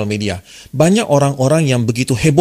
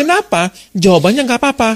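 much higher, exasperated "why?"; 10 semitones higher than usual. Retold or imitated. Teacher mocking the boyfriend's confusion, pitched high in exasperation. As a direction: throw up your hands in disbelief.

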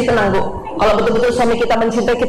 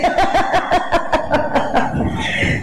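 breathy laugh; 3 semitones higher than usual. Real, not acted. Laughing at the joke.

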